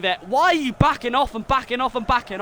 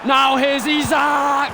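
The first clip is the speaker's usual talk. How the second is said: slow, louder shout rising in pitch; building goal call; 4 semitones higher, 7 dB louder, much slower than usual. Real, not acted. Fan calling the attack before the second goal, excited shouting. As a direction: stretch out the call as the chance builds.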